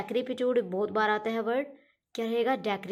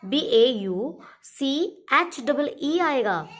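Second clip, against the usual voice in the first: slow spelling out; 4 semitones higher, 4 dB louder, much slower than usual. Shown as a demonstration. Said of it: "spelling आप इसकी रट लीजिएगा".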